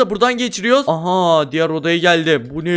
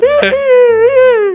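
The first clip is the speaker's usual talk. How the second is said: high startled scream; startled shriek; 8 dB louder than usual. Real, not acted. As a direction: shriek in fright. Shriek as monster appears.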